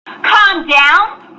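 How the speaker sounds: usual speaking voice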